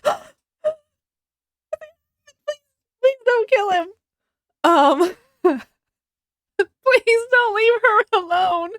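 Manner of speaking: high, strained plea, slowing at the end; a reader begging the author to spare him; desperate pleading. Acted. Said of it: "you're like".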